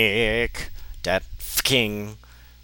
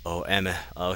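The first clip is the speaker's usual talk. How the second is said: normal talking voice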